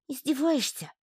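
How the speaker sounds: высоко, тянуто и грустно; протяни обиженно тонким голоском; грустно протянуто. A narrator's reading. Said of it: "грустно протянул Гишка". (Гишка обиженно тянет: «Издеваешься?» тонким голоском)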